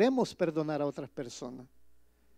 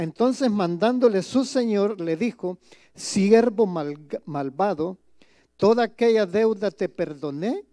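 scripture reading; 5 dB louder than usual. Acted. Pastor reading the parable aloud to his congregation, solemn and loud. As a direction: proclaim the verse to the congregation.

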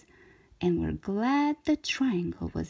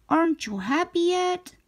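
higher, teasing magician voice; lightly voiced shapeshifter, pitched higher; 6 semitones higher than usual. A narrator's reading. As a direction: needle the shape knowingly. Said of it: "the shapeshifter asked".

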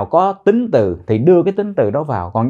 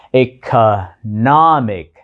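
Economic slowly, stress on the "nom"; teacher drilling Economic syllable by syllable; stress on third syllable; much slower than usual. Shown as a demonstration. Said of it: "nhấn vào âm tiết thứ ba".

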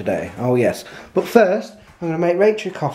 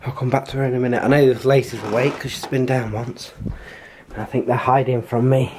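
ordinary talk

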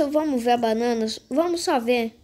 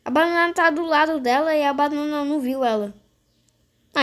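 normal talking voice

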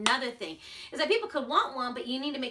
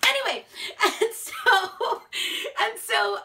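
laughing; 10 semitones higher, 7 dB louder, slower than usual. Real, not acted. Laughing after joke.

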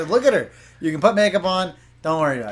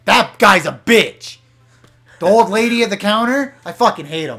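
mock fury; 3 semitones higher, 6 dB louder than usual. Real, not acted. Complaining loudly about NPC.

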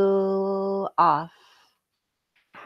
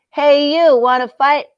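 grouchy shout; 8 semitones higher, 12 dB louder than usual. Acted. Grouchy ladybug picks a fight.

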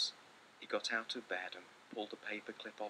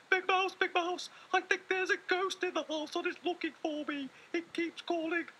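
much higher, louder frightened little voice; frightened cry; 8 dB louder than usual. Acted. Little Mouse crying to Big Mouse about a ghost, frightened high call. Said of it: "he cried".

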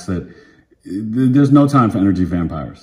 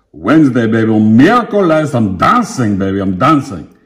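playful; 3 semitones higher, 6 dB louder than usual. Real, not acted. Playful sign-off to viewers.

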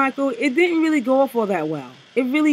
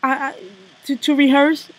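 loud, excited mock rant, pitched higher; mock Kim rant; 5 semitones higher, 7 dB louder than usual. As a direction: ham up a breathless, over-hyped performer yelling at her crew. Opening quote of Kim's stage rant.

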